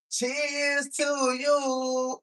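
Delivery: stretched, slow toast. Shown as a demonstration. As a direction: draw each word out. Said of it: "Let me try it one more time".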